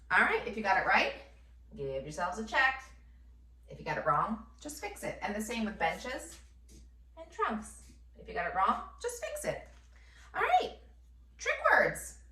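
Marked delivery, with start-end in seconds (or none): 4.6-5.2 light high reassurance. Acted. Teacher lightly reassuring students. 9.0-12.2 high sing-song lift, much higher; sing-song cheer. Acted. Teacher cheerfully moving her class on to trick words.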